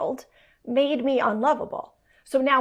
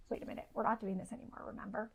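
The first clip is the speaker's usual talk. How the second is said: much softer, gentle warning; hushed reminder; 2 semitones lower, 16 dB quieter, much faster than usual. Retold or imitated. Wife quietly reminding husband of their new rules, soft. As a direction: remind him quietly, almost under breath.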